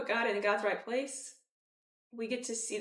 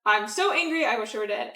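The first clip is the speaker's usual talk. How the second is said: Jonah's outburst; 7 dB louder than usual. Retold or imitated. Jonah sulking at God, loud angry whine. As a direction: spit it out like a sulking prophet.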